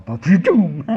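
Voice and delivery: loud, booming vocal thunderclap; sudden loud spoken sound effect; imitated lightning strike. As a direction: boom it out like a bolt hitting.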